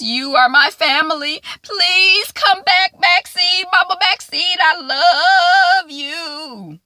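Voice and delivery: high, loud, slow mock pleading; hammed-up tearful plea. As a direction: beg her to come back, drawn out. Kibby confessing, begging Maxine.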